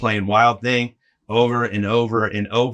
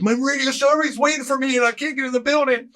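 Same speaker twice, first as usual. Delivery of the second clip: panicked; 13 semitones higher than usual. Retold or imitated. Panicked kid locked out.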